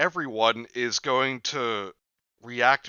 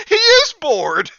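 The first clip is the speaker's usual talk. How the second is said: excited laughing exclamation; 13 dB louder than usual. Real, not acted. Laughing realization.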